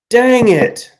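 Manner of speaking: high, loud yelp of frustration; exasperated outburst. Real, not acted. Guess was right too soon.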